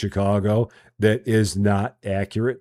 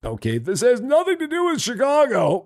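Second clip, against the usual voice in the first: much higher, put-on dismissive voice; mock exasperated snob; 14 semitones higher than usual. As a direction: scoff like a fed-up local. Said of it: "I'm like".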